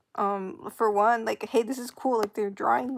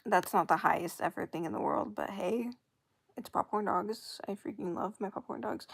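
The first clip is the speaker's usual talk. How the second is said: usual speaking voice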